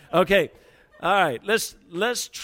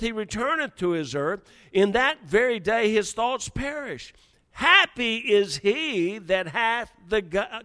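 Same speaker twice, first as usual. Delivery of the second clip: raised, measured preacher reading; preaching scripture reading; 4 semitones higher than usual. Acted. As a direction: read the verse to the congregation.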